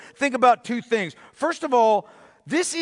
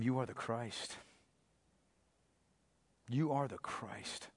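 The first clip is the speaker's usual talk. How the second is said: much softer, slow, hushed; soft confession; 10 semitones lower, 18 dB quieter than usual. Acted. Preacher voicing Peter's confession to Jesus, hushed and reverent. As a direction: say it like a reverent secret.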